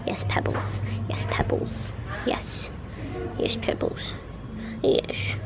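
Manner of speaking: whispered eager dog voice; soft whispered pretend dog; voicing the dog. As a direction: play the dog whispering excitedly about the bunny. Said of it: "and he's like".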